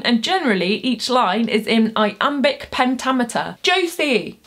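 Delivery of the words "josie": high, bright call of a student's name; student shouting teacher's name; calling out in class. Pretend. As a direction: call out like an eager pupil. Calls herself as a student.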